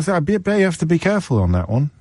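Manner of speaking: ordinary delivery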